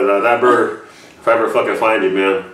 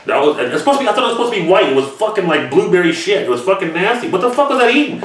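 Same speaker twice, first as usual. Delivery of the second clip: much higher, worked-up disgust; disgusted rant; 9 semitones higher than usual. Real, not acted. Ranting to Nance about the blueberry bird poop, disgusted. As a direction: go up high and gross out over it.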